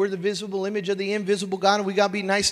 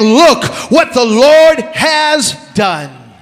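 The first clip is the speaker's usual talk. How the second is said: loud, high, driving preacher; full-force preaching; 5 semitones higher, 14 dB louder than usual. Real, not acted. Preacher rallying the church that God will fix this year, impassioned shouted preaching.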